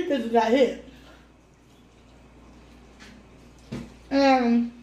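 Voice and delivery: talking while biting food; speaking between bites of chicken; mouth full. Real, not acted. Seen 0: Woman bites chicken wing at lips mid-speech, then talks chewing.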